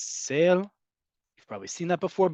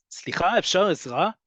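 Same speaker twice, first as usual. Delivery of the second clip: forceful; 4 semitones higher than usual. Shown as a demonstration. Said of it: "it's all about tone".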